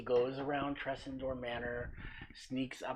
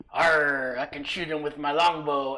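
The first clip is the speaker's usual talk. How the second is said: Rowan's pirate-like voice; 2 semitones higher, 11 dB louder than usual. Acted. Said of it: "Rowan's sitting there on top of the hill going".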